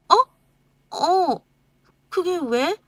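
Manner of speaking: high, startled cry, then unsure; wife startled by husband's outburst. Acted. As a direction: caught off guard. Wife caught off guard.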